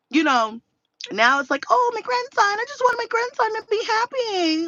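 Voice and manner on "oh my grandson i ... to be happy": very high mock pleading; doting grandma. Grandma just wants grandson happy, mocking high plea. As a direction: mimic a gushing grandma, hammed up.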